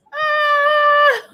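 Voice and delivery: mock gasp. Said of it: "I was like".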